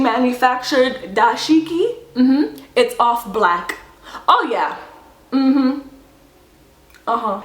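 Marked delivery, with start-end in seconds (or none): none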